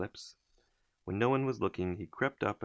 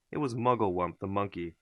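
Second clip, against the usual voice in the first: ordinary delivery, nothing marked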